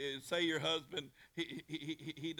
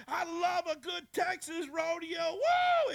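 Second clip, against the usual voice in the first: much higher, loud, slow cheering; fired-up shout; 7 dB louder, slower than usual. Retold or imitated. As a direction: shout it to the rodeo fans. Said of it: "he kept going on".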